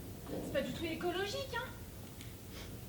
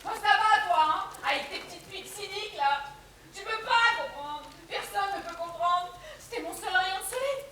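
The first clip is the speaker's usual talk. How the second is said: raised, higher voice, defensive; loud, self-pitying protest; wounded outburst; 4 semitones higher, 6 dB louder than usual. Acted. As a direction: lash back, hurt and misunderstood. Stung, defends herself against cynical listener.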